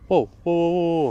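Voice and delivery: loud, high alarmed whoas; shocked, backing away; startled whoa. Real, not acted. Startled by light in forest.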